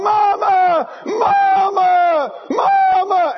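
high, loud cries of Mama; panicked calling. Retold or imitated. Eaglet clinging as the mother rockets up, crying Mama. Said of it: "the eagles are digging in and hanging on".

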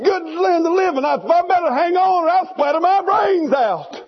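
hammed-up fear of falling; frightened eaglet. Eaglet looking down from the height, mock panic. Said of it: "He said".

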